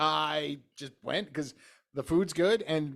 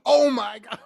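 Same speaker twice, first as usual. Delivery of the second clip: louder, higher embarrassed exclamation of "Oh my God"; mortified oh my god; 8 semitones higher, 11 dB louder than usual. Retold or imitated. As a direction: blurt "Oh my God" in sudden shock. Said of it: "I actually did the "Oh my God" face".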